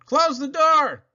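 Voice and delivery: high shouted order; loud, high, urgent call; urgent cry. Acted. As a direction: yell it over the storm. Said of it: "Noah cried".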